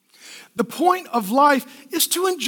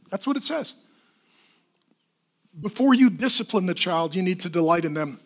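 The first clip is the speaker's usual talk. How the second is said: ordinary talk, nothing marked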